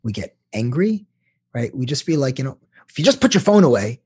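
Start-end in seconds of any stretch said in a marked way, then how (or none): 2.9-4.0 sharp, raised annoyed voice; irritated complaint. Retold or imitated. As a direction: snap at your partner about the phone. Partner angrily snapping about phone.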